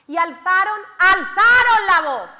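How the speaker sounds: loud dramatized narration. Acted. Preacher acting out the lepers raising their voices, booming. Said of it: "estoy teatralizando".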